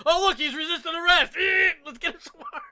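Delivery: loud, high-pitched mock-police yelling; mock cop shout. Cop calling out resisting arrest, high mock shout. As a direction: yell it like an overeager cop. Acting out police tasing a suspect.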